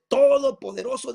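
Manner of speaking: loud, high declaration; powerful proclamation. Acted. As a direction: thunder it out like a voice from heaven. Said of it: "esta voz potente, potente, que declaraba". (voz potente que declara)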